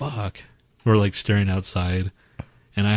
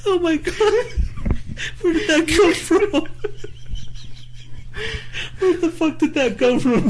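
high incredulous laughing words. Real, not acted. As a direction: laugh through the disbelief. Stunned by friend's monster voice.

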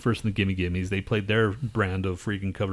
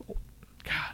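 whispered god; 12 dB quieter than usual. Real, not acted. Awed by old band.

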